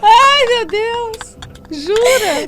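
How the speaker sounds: high, slow, drawn-out cry of amazement; gasping in delight at the punchline; surprised laugh. Real, not acted. Co-host reacting to the pastor's story with real amazement.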